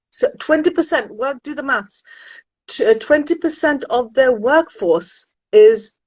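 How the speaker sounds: ordinary delivery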